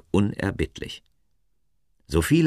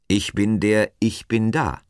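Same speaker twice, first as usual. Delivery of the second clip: higher, louder solemn proclamation; God, proclaiming; 5 semitones higher, 5 dB louder than usual. A narrator's reading. God reveals his name to Moses, proclaiming. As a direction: declare it as a divine name.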